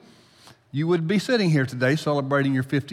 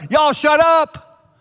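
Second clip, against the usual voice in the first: quarterback yelling; 11 semitones higher, 9 dB louder, much slower than usual. Retold or imitated. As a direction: shout it at the stands, dragging it out. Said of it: "he's going".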